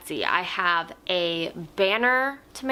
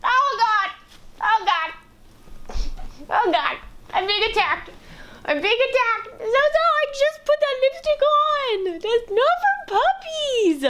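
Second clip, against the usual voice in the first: excited high squeals. Real, not acted. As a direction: squeal while the puppy jumps. Puppy attacking her, laughing.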